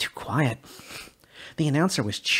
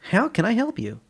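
higher, bright service voice that rings hollow; fake chipper; 11 semitones higher, 5 dB louder than usual. Shaw greeting Sully at the counter, fake cheer. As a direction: sell the cheer without meaning it. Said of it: "unconvincingly chipper tone".

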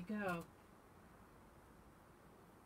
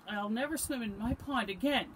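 higher, louder, mildly tearful lament; sniffing complaint; 5 semitones higher, 9 dB louder than usual. A narrator's reading. Frog sets off through woods, mildly tearful. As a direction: lament it with a touch of upset. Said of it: "sniffed Frog".

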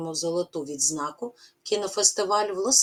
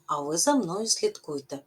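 ordinary talk

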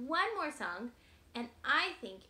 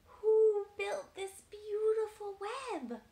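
high, loud, slow owl; 8 semitones higher, 9 dB louder, much slower than usual. Acted. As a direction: ask it like a wise owl. Owl character voice.

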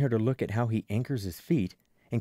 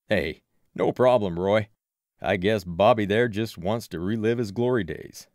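louder voice of a grown man; easygoing adult helper; 2 semitones lower, 5 dB louder than usual. A narrator's reading. Adult helper answering the boy's thanks, loud and casual. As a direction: play the adult brushing off thanks, loudly.

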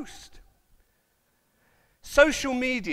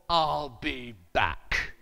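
very slow, low, flat robotic delivery; robot movie line; 10 semitones lower, much slower than usual. Retold or imitated. Preacher imitating the Terminator, slow and low. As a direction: say it like a movie cyborg.

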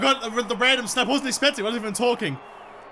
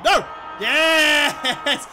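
triumphant cry; 4 semitones higher than usual. Real, not acted. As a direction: yell in elation as the match is won. Final siren, wins match.

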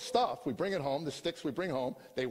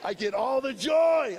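big, slow, higher joyful shout; 10 semitones higher, 9 dB louder, slower than usual. Real, not acted. Grandfather shouting his joy over the grandbabies, loud slow exultant cry. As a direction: proclaim it to the whole room, slow and loud. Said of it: "exulting about grandbabies".

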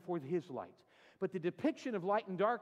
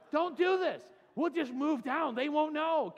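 mock warning; 8 semitones higher, 6 dB louder than usual. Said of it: "she warned him not to do that".

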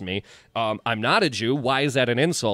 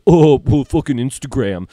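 hammed-up oblivious audience member; mocking phone guy; 3 semitones higher, 10 dB louder than usual. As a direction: sneer at the guy glued to his phone. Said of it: "on your goddamn phone like".

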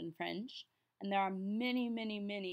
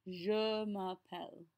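ordinary talk, nothing marked

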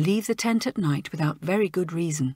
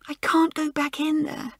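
quiet sob; 8 semitones higher than usual. A narrator's reading. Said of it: "sobbed Joan quietly".

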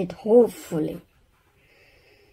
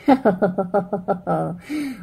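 a short laugh; laughing mum. Acted. Mother caught with the cake, laughing. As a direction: let a giggle out. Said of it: "Missus Lars began to laugh".

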